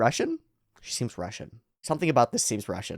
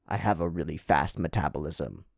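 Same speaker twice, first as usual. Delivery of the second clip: low, soft, even character voice; deadpan foreign lady; 8 semitones lower, 8 dB quieter than usual. Acted. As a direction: answer with grave composure. Flying lady answers, deadpan.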